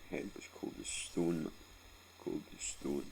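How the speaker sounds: ordinary talk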